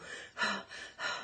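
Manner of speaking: demonstrated high, shallow breaths; shallow chest breathing. Shown as a demonstration. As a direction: breathe audibly up in the chest, as a bad example. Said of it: "I don't want you to do that".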